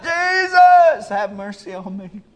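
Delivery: very high, loud cry for mercy; desperate cry. Acted. Blind Bartimaeus crying over the crowd, high and loud. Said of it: "he just drowned them out".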